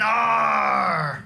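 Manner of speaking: loud pirate "Arr!"; pirate growl. Retold or imitated. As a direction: bark it like a big pirate. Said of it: "he went".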